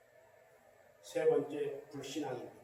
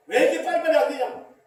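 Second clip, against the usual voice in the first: impatient complaint; 7 semitones higher, 7 dB louder than usual. Acted. Voicing impatient people.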